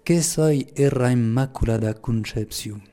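slow quotation in Lourdes patois. Shown as a demonstration. Speaker quotes Mary's enigmatic phrase to listeners, in slow Lourdes patois. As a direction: say it slowly with a local Pyrenean patois pronunciation. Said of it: "je vais le dire en patois".

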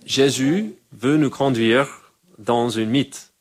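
said slowly, leaning on "nous"; slow it right down and weigh each word, "nous" most; lent et appuyé. Shown as a demonstration. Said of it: "C'est pas trop mythique, ma prononciation". (lentement, en appuyant sur « nous »)

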